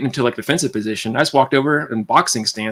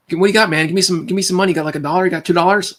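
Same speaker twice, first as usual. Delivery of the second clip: higher, pushy demanding voice; pushy bully voice; 7 semitones higher than usual. Retold or imitated. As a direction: press him for cash, pushy.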